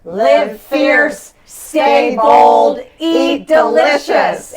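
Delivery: loud, punchy rallying cry, last phrase slow; slogan shout. Acted.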